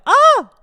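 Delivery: sudden loud, very high-pitched scream; effeminate yelp. Retold or imitated. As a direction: imitate your own shrill, startled "ah". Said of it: "It was the gayest".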